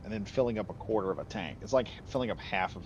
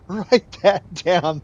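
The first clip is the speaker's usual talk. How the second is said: laughing it out, louder and slower; cackling with glee as he dictates; laughing Cossack; 9 semitones higher, 17 dB louder, much slower than usual. Retold or imitated. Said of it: "laughing their heads off and going".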